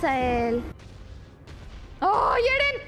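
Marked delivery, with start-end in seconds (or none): 2.0-2.8 loud, high exclamation; startled cry. Real, not acted. Cries out during the fight. (grito agudo de sorpresa)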